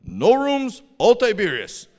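high, loud swooping refusal; mock blunt clerk voice; imitated hotel clerk. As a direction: mimic an insistent local clerk. Retold hotel clerk refuses them.

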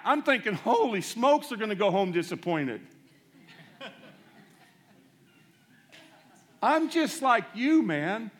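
loud, high preaching that drives every line home; full-force preaching. Acted. Preacher tells the church about his weakness, at full force. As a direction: preach it out at full power to the crowd.

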